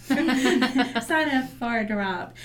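slow, loud words through a laugh; laughing about jumping off the cliff again; laughing joke. Real, not acted.

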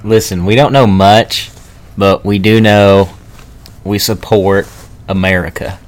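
lightly voiced hillbilly twang. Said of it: "go with the weird voice".

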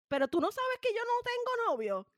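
high-pitched, incredulous retort; mock exasperated protest. Niece answers relatives asking about boyfriends, high exasperated protest. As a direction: protest it high, as if they should know.

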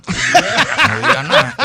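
high, slow, laughing plea; urging quote. Retold or imitated. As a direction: drag it out, urgent. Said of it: "Yo le decía". (súplica aguda y lenta entre risas)

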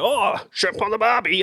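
high, hammed-up Aussie accent imitation; mock Australian accent. Americans hearing the accent and parroting it, mock Australian accent. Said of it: "hear the accent, they go".

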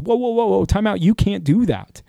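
high-pitched protesting objection; Habakkuk. Acted. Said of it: "And Habakkuk says".

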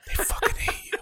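stage whisper aside. Acted. Host revealing what buddies really think, whispered. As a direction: let the secret slip out in a whisper.

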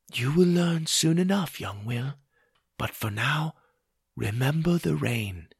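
soft, distant, solemn goddess; Mother Moon. A narrator's reading. Mother Moon foretells to the boy, solemnly and softly. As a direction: hush it like an oracle.

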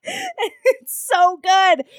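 high laughing squeal. Real, not acted. Laughing at the show.

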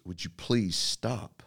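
slow, soft mother's plea, lightly voiced; a weary mother asking him to stop, drawn out slowly; hint of a tired mom; mom's plea. Mom answering the searching son.

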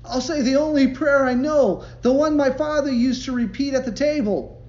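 very high, very loud hurried prayer, slowing at the end; frantic prayer. A narrator's reading. John praying table grace while chased by the bull, frantic. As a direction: shout grace in panic, laughing at the close.

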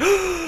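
sharp high gasp; loud acted gasp of anticipation. Moviegoer hearing about the Illuminati, excited gasp.

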